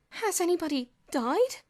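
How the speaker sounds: high, loud frightened question; terrified. A narrator's reading. George asking Boots in terror about the epidemic. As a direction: ask it in terror.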